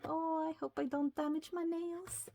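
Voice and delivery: ordinary talk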